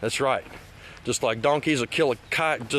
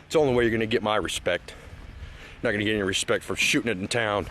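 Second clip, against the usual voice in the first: ordinary talk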